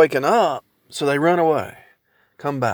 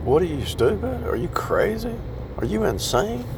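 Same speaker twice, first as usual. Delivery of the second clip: higher, softer mock disbelief at his wife; incredulous retort; 6 semitones higher, 5 dB quieter than usual. Husband retelling his reply to his wife, mock incredulous. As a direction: toss it back, half-mocking, wide-eyed. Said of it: "I don't even think I said it like that".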